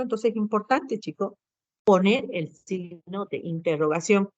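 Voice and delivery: normal talking voice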